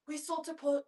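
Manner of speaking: put-on slipping into a British accent mid-cue; a hint of her British father's accent, laughing. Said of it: "God, my British father".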